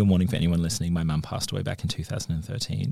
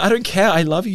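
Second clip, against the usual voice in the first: much higher and louder, insistent; emphatic sister; 13 semitones higher, 11 dB louder than usual. Retold or imitated. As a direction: insist on it, all love. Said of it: "She's like".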